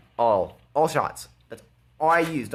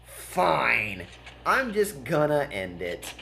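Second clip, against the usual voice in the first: slow, drawn-out "fine, I'm done" decision; a lightly acted give-up; mock resolve; 4 semitones higher, much slower than usual. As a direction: play it as a put-upon sigh of surrender. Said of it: "And then I decide".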